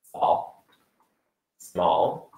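small whispered then softly echoed; fading whisper. Shown as a demonstration. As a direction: let small fade to a whisper. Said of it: "starting small".

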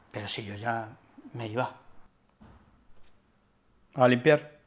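very soft trailing mutter; barely audible evasive mumble; mumbled excuse. Acted. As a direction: slip the excuse out under your breath.

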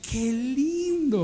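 high, very slow cooing "qué lindo"; fake praise. A parent's guest cooing slowly over an ugly baby. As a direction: coo it slowly, sweetly and insincerely. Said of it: "Y usted ve al niño y dice".

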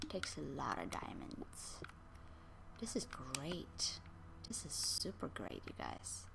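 hushed, delighted whisper; awed whisper. Real, not acted. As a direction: whisper like hoarding treasure. Whispering over diamonds.

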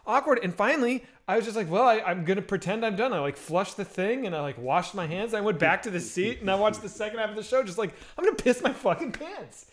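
usual speaking voice

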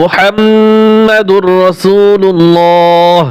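মুহাম্মাদুর রাসূলুল্লাহ stretched slowly with doubled m; slow recitation. Shown as a demonstration. Teacher modelling the kalima for learners. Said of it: "অনেকেই ভুল করে".

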